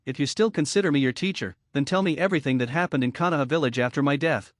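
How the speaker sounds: ordinary delivery, nothing marked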